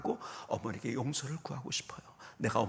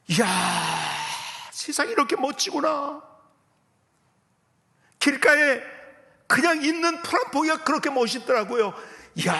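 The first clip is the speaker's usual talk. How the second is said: loud, high cries of wonder; awestruck exclamation; 10 semitones higher, 11 dB louder than usual. Retold or imitated. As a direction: marvel at full voice, relived from the pulpit. Relives marvelling at the world.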